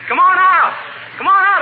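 loud call toward the neighbor's house; hollering over the fence. Acted. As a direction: shout him out of the house. Calling Gildy out of his house.

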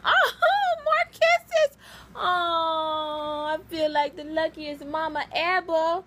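high, teary laughing voice; tearful joy. Real, not acted. Mama crying happily over her dog's kisses. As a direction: choke up with happy tears.

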